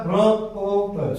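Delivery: very slow, रो ओ त drawn apart sound by sound; slow spelled-out रो ओ त; slow syllables. Shown as a demonstration. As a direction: break it into its sounds slowly. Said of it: "अब बोल के देख लीजिए".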